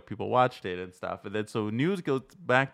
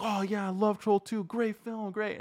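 much higher, gushing fan voice; 12 semitones higher than usual. Retold or imitated. Fans in line greeting the director, gushing high. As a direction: squeal it like an eager fan. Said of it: "the people in the audience are like".

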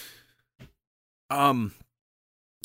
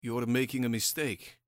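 soft, resigned mock warning; weary sigh; 3 semitones lower, 8 dB quieter than usual. Rasputin facing the revolver, quiet weary warning. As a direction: say it quietly, as if sighing. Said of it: "he just sighs".

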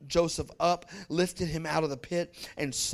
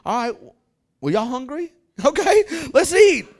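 loud, laughing call to eat; laughing brothers; 7 semitones higher, 10 dB louder than usual. Acted. Brothers laughing loudly as they sit down to eat. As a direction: laugh it off and call everyone to dinner.

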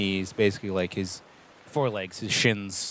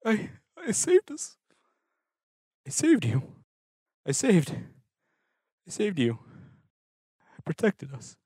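high, strained pleading, voice cracking upward; desperate pleading; 8 semitones higher than usual. Acted. Salt pleading with the crew he saved, high and strained. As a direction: insist on it, half-broken. Salt breaking down, defending himself.

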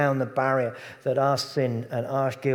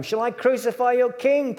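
high, louder challenge; Pilate asking; 10 semitones higher, 5 dB louder than usual. Acted. Pilate challenging the crowd, high and loud. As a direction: throw the question back at them. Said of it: "Pilate answered".

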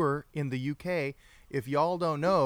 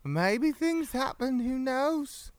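high, tipsy British pirate voice; mock-British swagger, pitched up; drunk pirate British accent; 6 semitones higher, slower than usual. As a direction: put on a British pirate accent to win her over. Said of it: "and so I talk like this".

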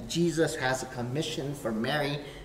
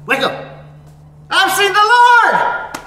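very high, loud, slow shouting; wake-up yell; 17 dB louder than usual. Acted. Pastor like a teacher yelling to wake the class. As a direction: jolt the room awake with it. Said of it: "they yell just to wake you up".

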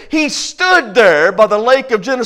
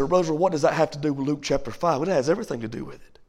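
much softer, quick aside; quiet aside; 9 semitones lower, 14 dB quieter, much faster than usual. Acted. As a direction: drop way down and hurry. Quiet aside, imagined question.

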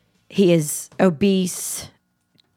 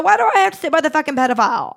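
exasperated outburst; 8 semitones higher, faster than usual. Retold or imitated. Outburst getting up from table.

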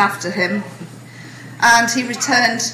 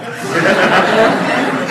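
laughing; 5 dB louder than usual. Real, not acted. Audience laughter at punchline.

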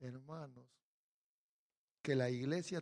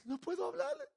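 Moses' excuse; 13 semitones higher, faster than usual. Retold or imitated. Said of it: "puro cuento".

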